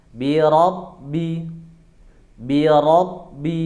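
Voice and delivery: "Rabbi" slow, with a heavy, back-of-mouth rolled ro; thick ro in Rabbi. Shown as a demonstration. Teacher models "Bi Rabbi" slowly for students, with a heavy ro. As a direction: draw out "Bi Rabbi", raising the back of the tongue. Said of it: "pangkal lidah dinaikkan".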